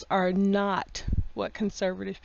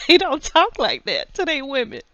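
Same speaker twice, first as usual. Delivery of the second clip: higher, louder, laugh-tinged protest; incredulous laughing; 4 semitones higher, 4 dB louder than usual. Real, not acted. Vlogger defending Southern men's talk to women, laughing incredulously.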